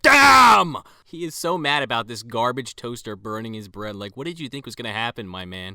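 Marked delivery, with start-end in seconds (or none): 0.0-0.8 loud, high-pitched imitation outburst; pissed-off yell. Imitating the boss smacking his burning toaster, pissed-off yell. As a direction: explode in one hammed-up yell. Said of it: "like, all pissed off".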